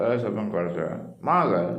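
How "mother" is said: "Mother" with a firm first syllable and falling end; weight the front of "Mother", let the rest fall; stress on first syllable. Shown as a demonstration. Teacher modelling the word whole, "Mother" stressed at the front. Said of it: "ശബ്ദം കൂടി പറയുക".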